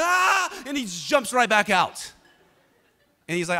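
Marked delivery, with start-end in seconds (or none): 0.0-0.6 another high yelp, a bit less loud; short sharp yelp of cold shock; scream. Retold or imitated. Friend jumps out of the ice bath, yelping. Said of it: "He's just screaming".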